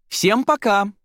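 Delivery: high, bright-pitched farewell to everyone; calling goodbye. A narrator's reading. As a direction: call out a cheery goodbye to the whole group.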